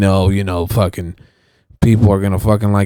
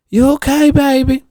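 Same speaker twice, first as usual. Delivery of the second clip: very high, imitated concerned neighbor; Rochelle; 3 dB louder than usual. Neighbor checking on him at the car window, high-pitched impression. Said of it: "Rochelle talks like that".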